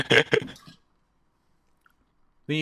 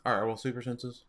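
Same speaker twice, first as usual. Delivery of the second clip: talking while chewing ice; words around crunching ice; 6 dB quieter than usual. Real, not acted. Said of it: "Sorry, I'm chewing on ice".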